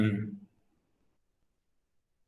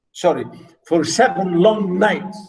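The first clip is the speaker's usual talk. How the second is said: ordinary talk, nothing marked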